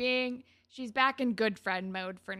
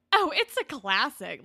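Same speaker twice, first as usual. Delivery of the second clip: loud, higher mock-earnest quote; earnest, irony-free; 4 semitones higher, 10 dB louder than usual. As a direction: imitate someone utterly sincere, loudly. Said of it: "no sense of, like, irony".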